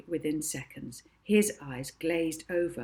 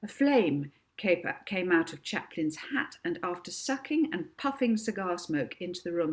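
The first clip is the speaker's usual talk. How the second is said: ordinary delivery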